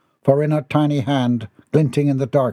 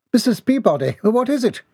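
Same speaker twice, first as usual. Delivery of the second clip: high, urgent question; high, worried character voice; alarmed concern; 11 semitones higher than usual. Acted. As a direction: call to a shocked woman with high-pitched concern. Alarmed at woman in shock.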